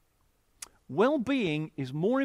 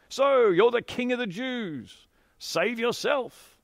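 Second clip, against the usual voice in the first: slow, higher, louder mock toast; 6 semitones higher, 4 dB louder, slower than usual. A narrator's reading. Soldiers mocking Jesus with sour wine, slow loud jeer. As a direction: sneer it slowly like a cruel joke. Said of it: "poked fun at him".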